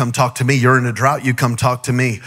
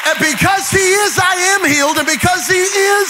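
preaching at full force; 6 semitones higher than usual. Acted. Preacher calling the church to praise, building to a shout. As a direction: preach it higher and higher to the crowd.

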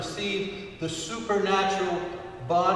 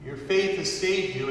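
usual speaking voice

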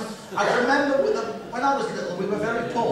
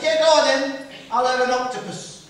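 eager order; 7 semitones higher, 5 dB louder than usual. Retold or imitated. Ordering octopus boldly.

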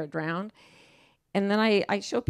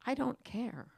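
calm indifference; 5 semitones higher, 7 dB quieter than usual. Retold or imitated. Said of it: "I just look at them and I say".

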